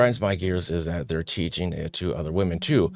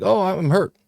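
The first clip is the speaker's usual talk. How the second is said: mock whiny hurt; 12 semitones higher, 5 dB louder than usual. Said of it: "he's like".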